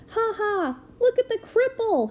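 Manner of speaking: high, mocking schoolboy jeer; taunting boy. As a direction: sneer it like a playground bully. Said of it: "he said".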